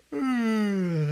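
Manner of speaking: loud, high put-on yawn; hammed-up sleepy yawn; fake yawn. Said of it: "that's a fake yawn".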